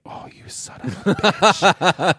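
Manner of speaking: hammed-up conspiratorial scold; mom scolding in fun. Mom teasing brother about the secret, mock scold. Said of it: "my mom looked at my brother like".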